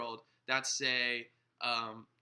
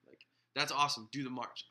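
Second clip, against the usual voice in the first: ordinary delivery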